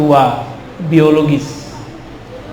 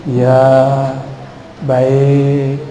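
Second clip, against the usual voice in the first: lower, drawn-out dutiful yes; politely obedient; 4 semitones lower than usual. Acted. Santri child answering lecturing parents, politely obedient. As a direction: answer like a well-mannered santri, low and drawn out. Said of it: "dia tahu adab kepada orang tuanya".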